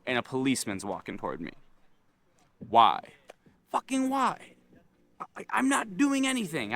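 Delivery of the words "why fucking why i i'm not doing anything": high-pitched, whiny-comic disbelief; exasperated protest. Retold or imitated. Retelling his reaction to an approaching policeman, exasperated protest. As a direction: shoot the pitch way up in mock protest.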